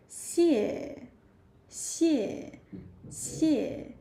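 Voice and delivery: 谢 as one blended syllable, falling in pitch. Shown as a demonstration. Teacher puts the sounds together for learners, 谢 falling. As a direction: join 西 and 耶 into 谢, tone dropping. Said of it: "Falling tone".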